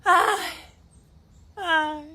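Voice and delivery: ordinary delivery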